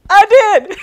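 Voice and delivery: loud, high, gleeful punchline; spring it on her with a grin; triumphant reveal. Retold or imitated. Reveals bald head to nurse.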